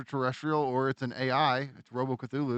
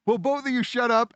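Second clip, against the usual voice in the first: mock exasperated yell; 9 semitones higher, 3 dB louder than usual. Third lady yelling at both.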